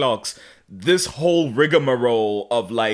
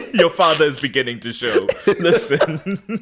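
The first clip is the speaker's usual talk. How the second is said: laughing through the words; can't stop laughing while joking; 5 semitones higher, 4 dB louder than usual. Real, not acted. Co-host cracking up over the recap, laughing.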